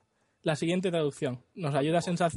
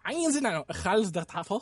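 mock German accent; 5 semitones higher, 4 dB louder, much slower than usual. German dub read, laughing.

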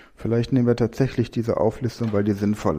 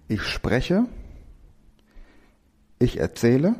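each verb set apart, slow and clear; slow dictation; slower than usual. Acted. Teacher slowly dictating verbs to class for mindmap.

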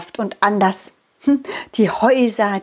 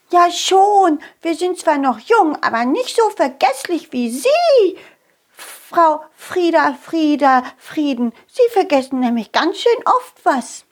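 put-on higher, cheeky child fairy; cheeky little fairy; 5 semitones higher than usual. Little fairy teasing forgetful Frieda Frieden. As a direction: tease the old fairy.

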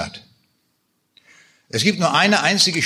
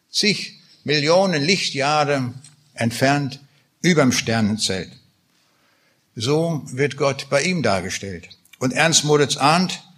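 ordinary talk, nothing marked

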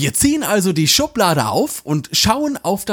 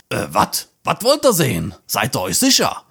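much lower, puzzled middle-aged man in a bathrobe; baffled man; 8 semitones lower than usual. Acted. Man in bathrobe asks the visitors, lower baffled voice. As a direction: play a dumbfounded slob caught off guard. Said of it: "fragt er kurz verdutzt".

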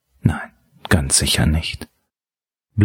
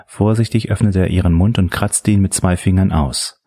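usual speaking voice

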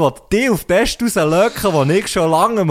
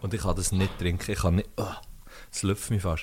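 puckering at a sour sip; drinking sour beer; 9 semitones lower, 10 dB quieter than usual. Real, not acted. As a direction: talk right after a sour gulp. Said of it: "Es ist so sauer".

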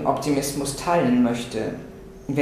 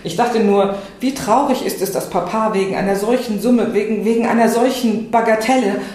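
higher, louder, tumbling over the words; flustered pleading; 6 semitones higher, 4 dB louder than usual. Acted. Else pleads with Dorsti for Papa, flustered and raised. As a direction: stammer out the plea, a bit breathless.

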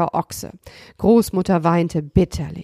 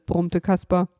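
ordinary delivery

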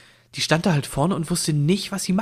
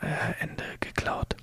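slow hushed whisper; 10 dB quieter, slower than usual. Real, not acted. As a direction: whisper it like a secret. Sneaky aside at episode end.

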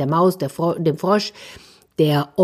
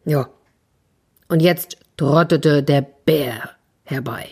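ordinary delivery

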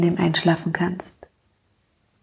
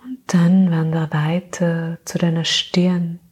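sleep meditation; 2 semitones lower than usual. Acted. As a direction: gently lull her into sleep.

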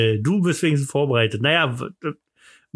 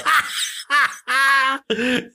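high giggling laugh; 11 semitones higher than usual. Real, not acted. Laughs at own joke. (hohes Kichern)

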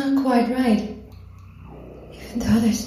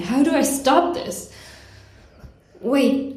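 usual speaking voice

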